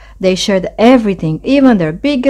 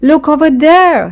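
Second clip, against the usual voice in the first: high, loud call of alarm; crying out; 10 semitones higher, 5 dB louder than usual. A narrator's reading. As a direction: call out to point something out. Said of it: "he cried out".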